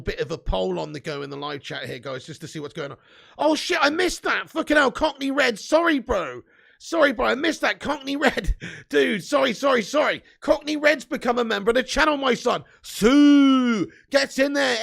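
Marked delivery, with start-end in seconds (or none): 3.4-10.2 loud, high-pitched, real apologetic outburst; flustered apology. Real, not acted. Streamer realises he missed a new member, flustered apology. 10.4-14.8 loud, high, triumphant announcement; celebratory shout. Real, not acted. Streamer celebrates new channel member, celebratory shout.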